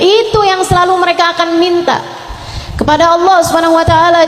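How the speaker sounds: normal talking voice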